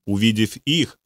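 ordinary delivery

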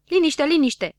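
high, loud mimicking jeer; mocking echo. A narrator's reading. Don Dănel mimics Habar n-am's plea, mocking. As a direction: parrot it back mockingly. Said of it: "îl îngână Don Dănel".

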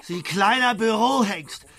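loud, furious name-calling; angry insults. Acted. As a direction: let him have it down the phone. Insulting boss on phone.

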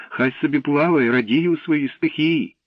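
put-on high, loud girlish plea; exclaimed objection; crying out. A narrator's reading. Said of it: "скрикнула вона".

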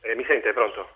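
distant speakerphone sound. Real, not acted. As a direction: talk from across the room on speaker. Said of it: "la sentiamo un po' distante".

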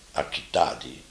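normal talking voice

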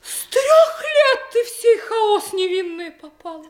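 high, laughing-crying outburst; tearful laughing gush. Acted. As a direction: overcome with mock pity. Sentimental outburst. (высоко, сквозь смех и слёзы)

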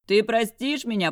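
loud, high call to a window; man bellowing his apology; yelling up. A narrator's reading. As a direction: shout up to her from below. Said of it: "проорал Глеб".

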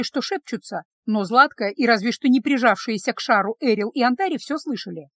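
ordinary delivery

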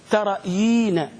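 "ترى يينا" slowly as ta-ra-yii-na; model pronunciation. Shown as a demonstration. Teacher answers how to pronounce it, drawn out. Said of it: "كيف تنطقها؟".

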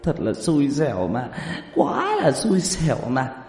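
hammed-up sobbing complaint; crying while talking. A narrator's reading. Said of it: "Tiểu Mã vừa khóc vừa nói".

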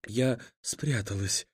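whispering while hiding. A narrator's reading. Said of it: "прошептала Рут".

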